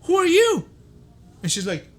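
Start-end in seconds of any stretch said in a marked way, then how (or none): 0.0-0.7 higher, louder, snapping it back; indignant shout. Retold or imitated. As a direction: fire the question right back at her. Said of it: "I'm like".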